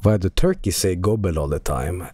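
low, slow, put-on voice; odd mock voice. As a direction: say it low in a silly voice. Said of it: "Why did you pronounce it like that?".

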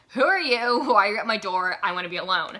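loud acted-out go-away snap; mock-annoyed rebuff at the door; hostile demand.

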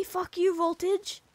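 ordinary talk, nothing marked

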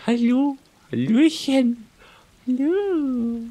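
put-on squeaky high baby-talk hello; high sweet voice for cats; cutesy high cat greeting. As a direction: coo at the kitties.

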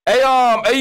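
ordinary delivery

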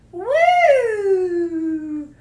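long, loud "woo" sliding down; big falling whoop of hype; celebratory whoop. Real, not acted. Cheering on stream.

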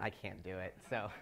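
ordinary talk

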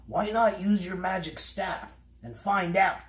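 high-pitched character voice, mouth full; mouth obstructed. A narrator's reading. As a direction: talk high around an eyedropper in the mouth. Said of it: "with an eyedropper in his mouth".

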